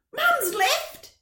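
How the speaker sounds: put-on high, upset little boy; upset child. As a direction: blurt it like a worried kid. Boy runs to Dad.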